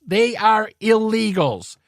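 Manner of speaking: slow, each word pressed down, illegals hit hardest; emphatic. Real, not acted. Repeating his point to viewers, slow hammering emphasis. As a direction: spell out illegals with slow force. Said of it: "I'll keep shouting it".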